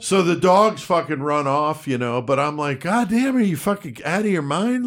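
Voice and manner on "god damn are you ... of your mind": higher, incredulous telling-off; half-laughing outrage; exasperated scolding. Retold or imitated. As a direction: scold a reckless partner in disbelief. Scolding the cop for shooting.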